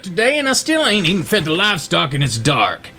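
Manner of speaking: high-pitched, exasperated country father; scolding farmer dad. Acted. Pa to his late son about undone chores, exasperated scolding.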